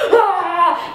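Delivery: sharp half-laughing "ah!"; mock pained yelp. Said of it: "So I'm like".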